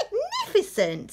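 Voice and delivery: very high, delighted exclamation; excited praise. Acted. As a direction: gush with admiration. Princess praises dragon.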